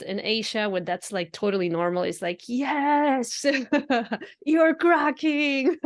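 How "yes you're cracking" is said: high, louder and slow through giggles; a giggly, high-pitched "yes, you're cracking"; laughing approval. Retold or imitated. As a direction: cheer them on, laughing with delight.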